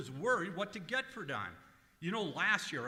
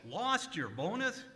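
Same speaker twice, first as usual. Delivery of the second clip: ordinary delivery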